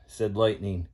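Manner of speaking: ordinary delivery, nothing marked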